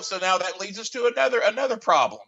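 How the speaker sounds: ordinary talk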